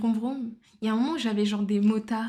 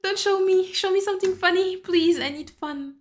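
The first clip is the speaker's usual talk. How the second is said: high, loud mock plea; hammed-up high-pitched begging; exaggerated pleading; 9 semitones higher, 4 dB louder than usual. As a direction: plead dramatically to be spared, easing off at the end. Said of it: "du coup, je suis en mode".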